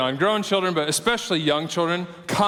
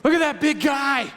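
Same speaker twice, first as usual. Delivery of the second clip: put-on toddler son's voice; 9 semitones higher than usual. Dad imitating his toddler son in the store, high child voice. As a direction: imitate a little boy pointing out a stranger. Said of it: "in his very low tone of voice".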